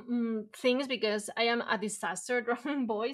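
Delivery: ordinary talk, nothing marked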